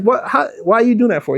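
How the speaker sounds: high, fast baffled questions; high-pitched baffled questioning; incredulous. Retold or imitated. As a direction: press him in quick disbelief. Questioning partner's six stores.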